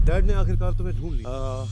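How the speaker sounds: loud, overjoyed cry of reunion; emotional outburst. Acted. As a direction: call it out to your lost son. Father finds lost son. (पिता की भावुक, ऊँची पुकार)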